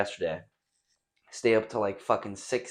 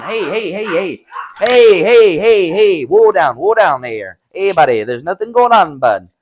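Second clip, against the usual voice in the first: high, loud hey hey to settle them; calling out; 10 semitones higher, 15 dB louder than usual. Real, not acted. Yelling at his barking dogs, loud high calling. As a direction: raise the voice over the barking.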